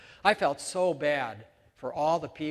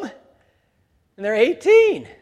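slow, much louder, higher; incredulous punchline; 6 semitones higher, 11 dB louder, much slower than usual. Real, not acted. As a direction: stretch it out in disbelief. Punchline on absurd grown baby.

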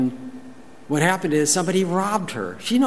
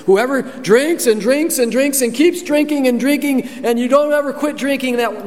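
high, louder mocking voice; mocking misquote; 11 semitones higher, 4 dB louder than usual. Preacher mocking a misreading of Jesus, high and mocking. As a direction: lampoon the wrong reading. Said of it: "No, it doesn't say that".

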